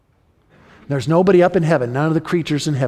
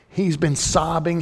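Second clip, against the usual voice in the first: ordinary delivery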